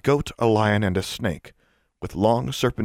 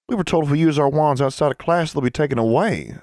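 lightly voiced girl, pitch raised; cautioning schoolgirl; 6 semitones higher than usual. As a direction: recite the rule like a worried pupil. Voices Leah.

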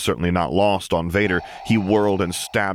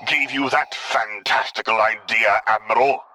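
spat; 4 semitones higher than usual. A narrator's reading. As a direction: drag out the contempt. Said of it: "Vader spat".

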